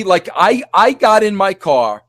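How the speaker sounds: normal talking voice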